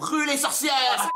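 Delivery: loud slow shout. Acted. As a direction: cry it out to the crowd. Mob calls to burn witch.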